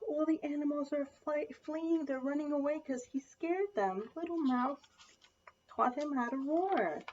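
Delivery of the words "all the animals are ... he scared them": plain reading voice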